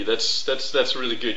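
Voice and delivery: ordinary delivery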